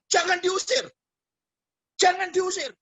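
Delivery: very high-pitched mock shriek; imitated screaming. Girl begging not to be evicted, imitated scream. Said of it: "dia teriak".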